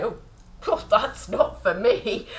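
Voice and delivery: a breathy, slowed, laughing brush-off; dismissive mock quote. Mocking a viewer who dismisses something new, breathy and slow. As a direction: mimic a doubtful viewer waving it away. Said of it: "Don't just say".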